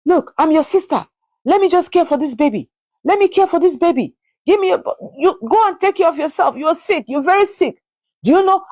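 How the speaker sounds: pushy sister, high and pleading; a lightly voiced pushy sister; insistent sister. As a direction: press her hard like the bossy sister. Sister pressuring her over the baby.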